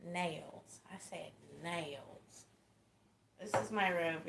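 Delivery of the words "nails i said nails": soft, drawn-out Texan "nails"; Texas accent. Shown as a demonstration. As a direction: stretch "nails" with a Texas twang. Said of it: "Did you hear that Texas accent just then?".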